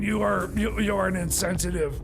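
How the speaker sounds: ordinary talk, nothing marked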